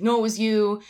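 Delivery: mock angry yell at a stranger; retold yell. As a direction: snap back as if blaming her. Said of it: "yelling at Nancy".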